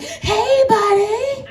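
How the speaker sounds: put-on very high, loud cheer for a child; over-bright celebratory shout; exciting cheer. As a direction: whoop it up to distract him. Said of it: "turned it into more like an exciting moment".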